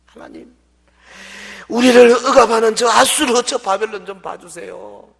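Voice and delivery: loud anguished plea to God; crying out. Retold or imitated. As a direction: cry out loud for rescue. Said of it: "부르짖습니다". (하나님께 부르짖는 외침)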